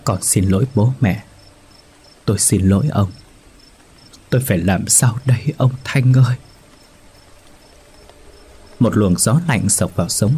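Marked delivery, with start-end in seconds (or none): 0.0-6.4 lightly voiced weeping widow, begging forgiveness; a hint of sobs, head bowed before the altar; sobbing apology. A narrator's reading. Widow apologizing to her dead husband's spirit, mildly tearful. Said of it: "Bà Hạnh gục đầu nức nở".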